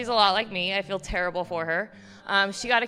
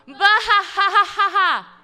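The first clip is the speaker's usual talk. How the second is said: loud, high mocking cackle; mocking belly laugh; 11 semitones higher, 10 dB louder than usual. Mom scoffing at daughter's plan, loud mocking laugh. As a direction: laugh the idea out of the room.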